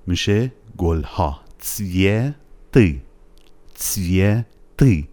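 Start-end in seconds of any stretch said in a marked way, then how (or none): 1.6-5.1 "تسویتی" slowly as tsvye, pause, thick ty; thick final i. Shown as a demonstration. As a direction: drag out "تسویتی" and end on a dark i. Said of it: "اون ای آخر دوباره از اون ایهای غلیظه".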